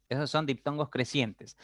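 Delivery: normal talking voice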